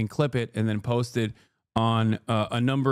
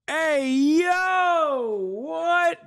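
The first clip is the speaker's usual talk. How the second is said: excited yelp; 13 semitones higher, much slower than usual. Real, not acted. Excited reaction continues.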